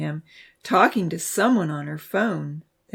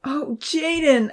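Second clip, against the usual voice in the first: high, slow wail; 10 semitones higher, 4 dB louder, slower than usual. A narrator's reading. Abby wails to Jaden, high and slow. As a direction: cry out in relief and distress. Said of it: "Abby wailed".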